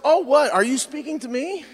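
high-pitched, innocent-sounding mock surprise; startled surprise. Mother-in-law finally hears him at dinner, mock surprise. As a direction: imitate someone suddenly noticing they're addressed.

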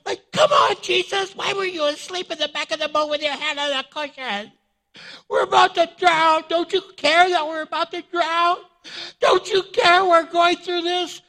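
very high, whiny wail; hammed-up distraught pleading. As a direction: wail accusingly at Jesus in mock despair. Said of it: "you can see her like this".

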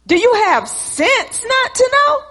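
high-pitched indignant question; exasperated tone. Retold or imitated. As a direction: act out a scornful scolding. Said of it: "Why did your tone change".

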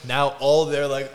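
normal talking voice

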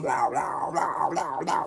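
rhythmic mouth-made wobble; pulsing vocal wub sounds; mimicked bass music. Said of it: "what Space Jesus sounds like".

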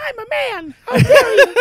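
mock outrage in a very high voice; pissed-off high protest. Kid mistaken for his mother on phone, squeaky outrage. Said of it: "it used to piss me off so much".

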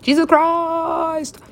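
high, long-drawn wail of shock; hammed-up astonished yell; mock shocked cry. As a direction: stretch it out into a high exaggerated cry. Acts out reaction to bull's eye.

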